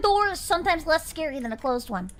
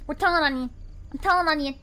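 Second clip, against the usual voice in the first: normal talking voice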